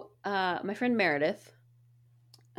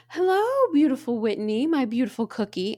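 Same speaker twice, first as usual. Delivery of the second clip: imitating Paula; 5 semitones higher, 6 dB louder than usual. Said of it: "she goes".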